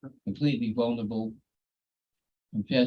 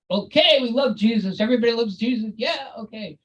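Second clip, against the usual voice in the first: much higher, louder hyped-up preacher; rock star preacher; 13 semitones higher, 9 dB louder than usual. Retold or imitated. The megachurch preacher revving up the crowd, loud and high. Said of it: "like a rock star, and he goes".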